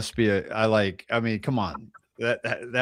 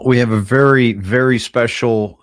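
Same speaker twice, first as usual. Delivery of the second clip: announcer; 12 dB louder than usual. Acted. Host opens the show loudly.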